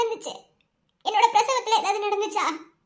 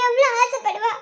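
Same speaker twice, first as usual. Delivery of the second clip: distraught plea broken by sobs; crying hard. Acted. Wife asking her husband why motherhood was denied her, crying hard. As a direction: plead tearfully, catching sobs between phrases. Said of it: "இப்ப ஏன் இவ்வளவு அழுதுட்டு இருக்க".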